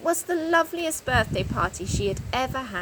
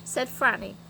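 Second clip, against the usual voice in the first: ordinary delivery, nothing marked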